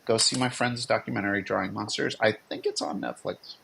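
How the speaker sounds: usual speaking voice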